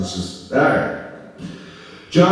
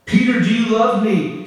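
Jesus asking; 8 semitones higher than usual. Acted. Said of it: "It's like this".

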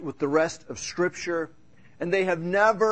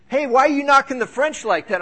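higher, louder mock-accusing question; retold challenge; 9 semitones higher, 5 dB louder than usual. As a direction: act out an indignant listener calling you out. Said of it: "from someone going".

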